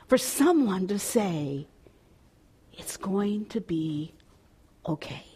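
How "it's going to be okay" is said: reassuring whisper. Retold or imitated. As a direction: breathe the reassurance gently. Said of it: "it would be so good, so comforting".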